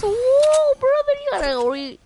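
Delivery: very high, loud shout of alarm; loud panicked whoa; startled yell. Real, not acted. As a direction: caught off guard mid-fight. Startled by enemy appearing.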